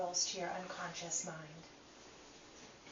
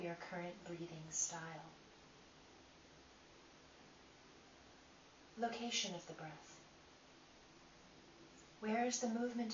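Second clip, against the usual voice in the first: calm, unhurried guiding voice with long pauses; guided meditation; 6 dB quieter than usual. Acted. Teacher guiding listeners through breath inquiry, calm meditation voice. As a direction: lead them inward one gentle question at a time.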